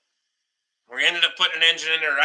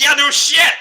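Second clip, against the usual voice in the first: mock exclaim; 10 semitones higher, 7 dB louder than usual. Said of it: "He's like".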